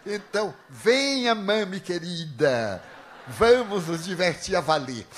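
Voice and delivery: mock sweet invitation. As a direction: lure her in with sugary mockery.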